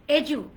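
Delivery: এজু as E-joo. Shown as a demonstration. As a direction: say এজু with a clear j sound. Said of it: "ডিটাকে বরকে যাওয়ার মতো উচ্চারণ হবে". (এজু স্পষ্ট জ দিয়ে)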